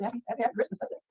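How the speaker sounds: soft greeting. Shown as a demonstration. Streamer rehearsing his room-raid greeting, softly. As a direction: say it softly, a little repeated. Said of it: "I'm gonna say Happy Christmas".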